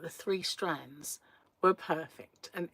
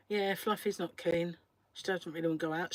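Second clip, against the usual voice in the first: usual speaking voice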